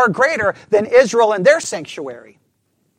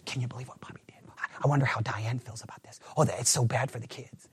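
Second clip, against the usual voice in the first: hushed whispered gossip, quicker; 9 semitones lower, 15 dB quieter, faster than usual. Acted. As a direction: lean in and whisper the scandal. Church members gossip about Bobby.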